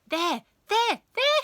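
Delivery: high, loud, excited pupil; climbing, insistent cries; urgent pointing out. A narrator's reading. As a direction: call it out as if tracking something darting about.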